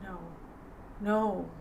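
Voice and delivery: softly, heavy with memory; a quiet, weighted refusal; quiet grim no. Retold or imitated. Veteran sergeant quietly turning down an eager would-be sniper. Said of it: "you could hear it in his voice".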